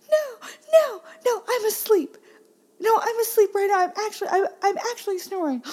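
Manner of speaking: very high, loud mock-child wail; crying daughter. Daughter crying, claiming to be asleep, wailing. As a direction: wail it like a tantruming kid, then fake snores. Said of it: "crying, trying to convince us".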